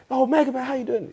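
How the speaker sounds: high, eager imitation of a star-struck fan; mimicking locals greeting. Locals in South Africa greeting Mega Man, high and eager imitation. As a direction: pitch it way up like locals greeting a celebrity. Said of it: "So they would say".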